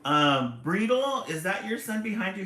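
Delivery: loud excited shout-out; calling out. Real, not acted. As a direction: shout a question to a viewer. Greeting a viewer on stream.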